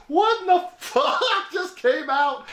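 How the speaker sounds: high, slow, drawn-out disbelief with a laugh; hammed-up dumbfounded squeal; baffled amazement. Truck owner watching the silent stranger leave, baffled.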